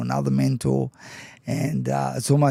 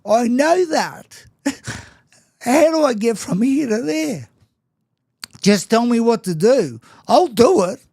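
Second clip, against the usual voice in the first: desperate pleading; 8 semitones higher, 4 dB louder than usual. Retold or imitated. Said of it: "And I'm like".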